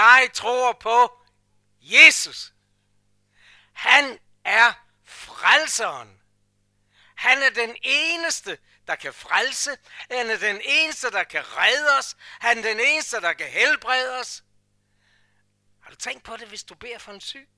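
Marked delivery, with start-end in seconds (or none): 0.0-2.5 loud, slow proclamation; loud proud declaration of faith; bold confession. Shown as a demonstration. 3.7-14.4 loud, rhythmic sermon proclamation; preacher building a fiery litany; preaching. Acted. Preaching at full force.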